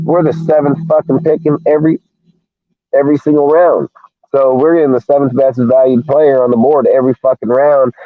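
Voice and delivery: rising, fast, heated sports-fan rant; fired up. Real, not acted. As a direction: pitch climbing high and fast with excitement. Heated rant about draft picks.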